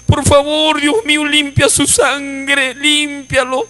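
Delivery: retold weeping plea, stretched words; tearful pleading prayer. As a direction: imitate a brother crying out to God. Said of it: "el hermano lloraba y decía".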